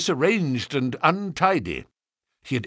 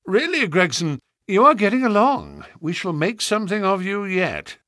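higher, patronising praise; encouraging; 8 semitones higher than usual. Acted. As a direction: cheer him on from above. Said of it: "said Holmes in an encouraging voice".

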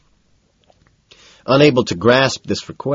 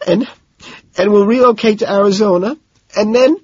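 pleading Lewis; 13 semitones higher than usual. A narrator's reading. Lewis pleading, high put-on voice.

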